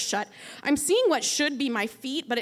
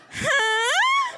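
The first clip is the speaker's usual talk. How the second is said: a much higher, loud, choked huh through a dry mouth; strained huh; 12 semitones higher, 4 dB louder than usual. Retold or imitated. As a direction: croak it out while raising the brush. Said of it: "And I just go".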